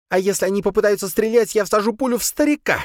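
high, tense threatening voice; threatening woman. A narrator's reading. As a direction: threaten them, voice pitched high. Sabrina threatens the police.

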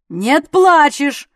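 loud, slow, forceful shout; bullying yell; shouted contradiction. A narrator's reading. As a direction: shout it down, drawing out each word. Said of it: "крикнул он".

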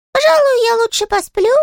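very high, tiny sulky voice; a small touchy critter, piping high; squeaky little creature. Acted. Щепкин's character voice.

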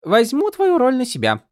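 high, light and teasing; a tiny sprite needling her friend; playful spren voice. A narrator's reading. Голос Сил. (высокий игривый голос духа)

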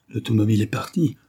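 usual speaking voice

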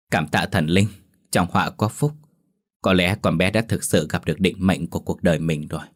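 usual speaking voice

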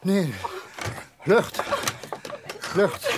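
breathless rising gasp for air; begging for air. Acted. Begs for air. (smekend om lucht, hijgend)

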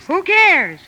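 high-pitched, swashbuckling bravado; rally the crew like a pirate captain; rousing rally cry. Acted. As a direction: exuberant mock-heroic call to charge. Rallies sisters like a pirate captain.

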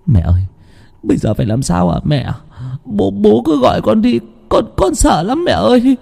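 put-on scared child pleading. A narrator's reading. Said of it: "sự hổ báo của nó".

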